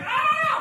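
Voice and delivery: high squeaky frog panic yelp; a sudden very high panicked squeak; Kermit the Frog yelp. Retold or imitated. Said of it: "I turn into Kermit the Frog".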